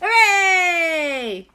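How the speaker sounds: very high, loud hooray; group cheer. Acted. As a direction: cheer like a crowd of happy kids. Everyone cheers at story end.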